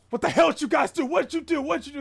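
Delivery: high, loud acted outrage; irritated, mad. Gang leader confronts his boys over a killing, mad. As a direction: demand it like a furious gang boss. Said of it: "really irritated and mad right now".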